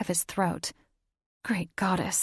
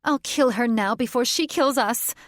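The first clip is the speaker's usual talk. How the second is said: higher, louder murderous shriek; shrill threat; 5 semitones higher, 5 dB louder than usual. Acted. Catherine threatens to kill the witch, shrill and loud. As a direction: spit the threat out high.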